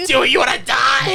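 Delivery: high, hammed-up shouted demand; mock frantic yell. Flight crew scolding passengers in an emergency, mock frantic yell. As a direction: screech it like panicked cabin crew.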